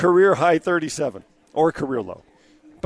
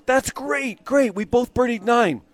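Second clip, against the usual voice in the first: pitched up high, slow and thrilled; excited Lee; 6 semitones higher, slower than usual. Retold or imitated. Impersonating Lee's excitement to the host. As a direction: gush like an eager golfer. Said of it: "He goes".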